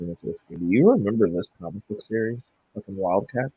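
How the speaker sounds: muffled, leaning off the mic. Real, not acted. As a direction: voice turned away from the microphone. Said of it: "You sound muffled as shit".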